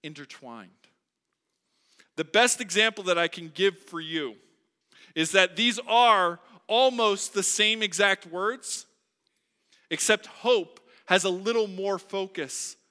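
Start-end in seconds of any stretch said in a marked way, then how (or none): 2.2-12.8 loud, driving pulpit voice; preaching at full force. Acted. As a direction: press the point home to the congregation. Preaching louder.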